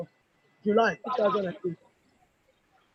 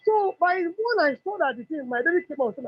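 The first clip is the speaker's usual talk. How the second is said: ordinary talk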